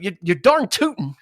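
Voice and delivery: laughing Minnesota "darn tootin'"; playful Minnesota twang, laughing; Minnesota accent. Pretend. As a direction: ham up the folksy Minnesota accent through a laugh. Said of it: "I'll do it".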